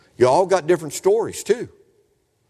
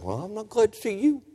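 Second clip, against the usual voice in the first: softer mock grumble to the preacher; imitated reluctant churchgoer's quiet comeback; unwelcoming retort; 5 semitones higher, 5 dB quieter than usual. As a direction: answer back under your breath, rising at the end. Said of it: "I wanted to tell him".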